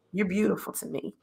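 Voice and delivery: soft, gentle reassurance; tender husband. Retold or imitated. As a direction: say it quietly like a loving husband. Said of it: "he'll always say".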